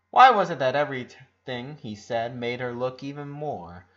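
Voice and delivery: plain reading voice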